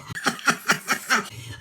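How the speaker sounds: put-on elf cackle, high and choppy; hammed-up high-pitched laugh in bursts; high staccato laugh. Host punctuating the channel intro, mock elf laugh.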